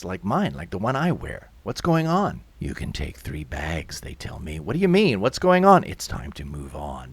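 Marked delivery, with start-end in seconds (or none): 2.6-4.0 low, soft, impassive worker's voice; indifferent official. Acted. Indifferent movers answering a desperate resident. 4.6-5.8 higher, louder, bewildered demand; alarmed protest. Acted. Resident protests being evicted. 5.8-7.1 low, soft, impassive worker's voice; indifferent official. Acted. Indifferent mover answers.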